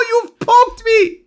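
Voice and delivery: high, loud wail of protest; hammed-up pained yelp. Caged merchant poked in the ribs, high pained wail. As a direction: yelp it as if just jabbed. Poked captive cries out in pain.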